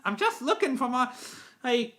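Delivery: high-pitched little engine; Thomas. Acted. Engine searching for his coaches, in a high Thomas voice. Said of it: "out of Thomas' voice though".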